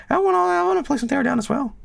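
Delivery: very high, quick whiny little voice; tiny high-pitched put-on voice; voice of the subconscious. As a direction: squeak it fast like an inner child begging. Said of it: "subconsciously I'm like".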